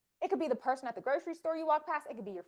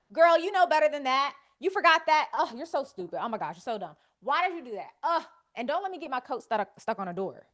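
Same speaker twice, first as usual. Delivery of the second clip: self-scolding; 2 semitones higher, 9 dB louder than usual. Retold or imitated. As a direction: scold yourself loudly like an exasperated friend. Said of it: "we go to war".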